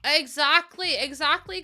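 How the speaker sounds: loud, high emphatic exactly; emphatic agreement. Real, not acted. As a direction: shout agreement at the chat. Agreeing loudly with chat.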